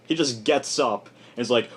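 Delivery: usual speaking voice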